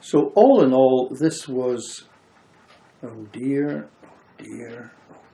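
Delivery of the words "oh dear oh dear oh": repeated "oh dear" fading from plain to a whisper; mock despair, dwindling to a whispered "oh dear". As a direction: let each "oh dear" die away until only breath is left. Repeated lament fading to nothing.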